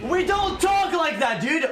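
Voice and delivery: high, indignant "we don't talk like that"; high exasperated objection; exasperated protest. Real, not acted. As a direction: push back at your friend, pitch up. Protesting the fake accent.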